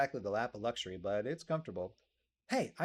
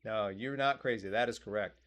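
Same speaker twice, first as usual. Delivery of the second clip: ordinary talk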